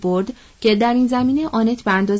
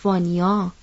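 a mild note of reproach; reproachful; 4 semitones lower than usual. Acted. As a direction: say the name as a gentle rebuke. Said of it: "با لحن سرزنش گفت".

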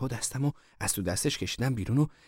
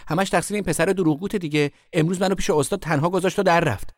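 high, loud blaming; indignant tattling; 9 semitones higher, 6 dB louder than usual. A narrator's reading. Sister blaming her brother to mother, indignant tattling. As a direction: pour out the complaint, pitched up and loud.

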